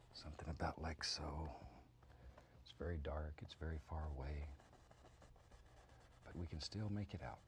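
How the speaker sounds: hushed, soothing painting lesson; soft-spoken painting instructor. Acted. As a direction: murmur the steps softly to the viewer.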